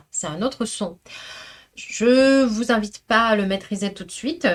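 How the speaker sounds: usual speaking voice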